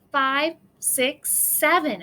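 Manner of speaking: ordinary delivery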